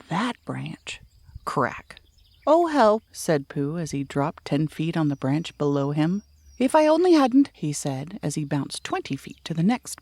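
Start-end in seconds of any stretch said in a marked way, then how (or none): 2.5-3.0 high, loud yelp of alarm; a falling bear's sudden cry for help; startled cry. Acted. Pooh falling from the tree. 6.6-7.5 high, loud yelp of alarm; a falling bear's sudden cry for help; startled cry. Acted. Pooh bouncing down branches.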